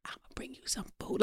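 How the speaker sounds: breathy unvoiced whisper; hushed secret whisper. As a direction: whisper it so nobody else hears. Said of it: "Like, I was whispering".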